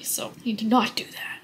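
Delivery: hushed self-reminder; whispering. Real, not acted. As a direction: whisper it to yourself.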